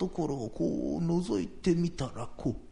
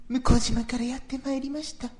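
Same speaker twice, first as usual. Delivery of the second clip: higher, a lightly voiced visitor at the door; ghostly visitor; 10 semitones higher than usual. Old man imitates the visitor at his door. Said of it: "とこう言うんだ".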